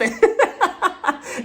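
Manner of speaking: high giggle burst; high laugh. Real, not acted. Laughing at her own bit.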